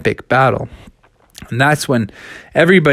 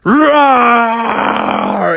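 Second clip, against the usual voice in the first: long, loud bear roar; mighty growl; 7 semitones higher, 3 dB louder than usual. Acted. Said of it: "let out a mighty growl".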